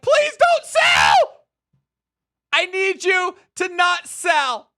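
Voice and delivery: high, loud, drawn-out begging yell; mock pleading shout. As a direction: beg them not to sell in a shrieking voice. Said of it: "This guy's like".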